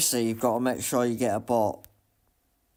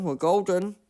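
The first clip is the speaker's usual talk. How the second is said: higher, louder gleeful repeat; excited; 7 semitones higher, 4 dB louder than usual. Real, not acted. Gamer cheering his clean lap, gleeful and loud. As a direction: sing it out, thrilled with the lap.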